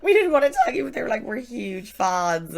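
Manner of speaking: high, loud gushing imitation; excited fans. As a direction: gush it like starstruck fans. Excited couple confessing, retold.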